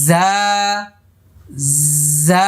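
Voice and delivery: জা buzzed lightly through the teeth; soft za. Shown as a demonstration. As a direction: say জা with a gentle buzz. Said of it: "আমার সাথে সাথে বলুন".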